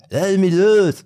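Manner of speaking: put-on high, loud drunken yell; drunk man yelling. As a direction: yell it like a drunk being held by officers. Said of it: "So hat der geschrien". (betrunkenes lautes Schreien, hoch)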